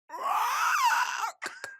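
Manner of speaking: high shrieking dragon screech; dragon impression. Showing a friend a dragon impression, high shrieking screech. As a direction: screech like a dragon about to breathe fire. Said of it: "now I'm gonna do my dragon".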